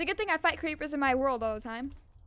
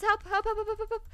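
frantic help; 6 semitones higher than usual. Real, not acted. Begging teammates for help.

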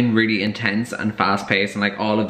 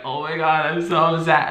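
higher-pitched mock upset; mock crying; 5 semitones higher than usual. Acting out someone crying before their tear gets wiped, mock upset. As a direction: pretend to be crying, half in earnest. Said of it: "someone's crying".

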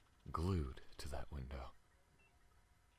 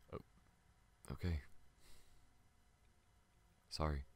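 ordinary delivery

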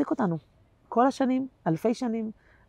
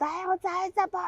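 put-on high, whiny little girl demanding through tears; crying child's voice; 11 semitones higher, 5 dB quieter than usual. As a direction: imitate the youngest daughter wailing for juice. Daughter crying over juice machine. (קול ילדה בוכה ודורשת, גבוה)

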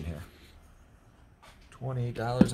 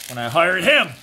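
joking punchline; 5 semitones higher, 13 dB louder than usual. Real, not acted.

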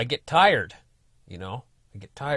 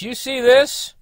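indignant neighbour; 10 dB louder, slower than usual. Retold or imitated. Angry neighbour confronting over cut tree.

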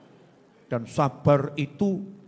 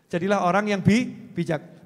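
ordinary delivery

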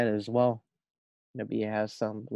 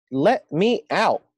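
calling out; 8 semitones higher, 12 dB louder, much slower than usual. Acted. Girl trapped in net, calling out.

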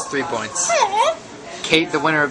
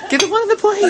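excited; faster than usual. Real, not acted. Urging friend in game.

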